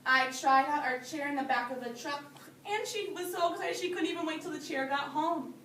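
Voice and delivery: usual speaking voice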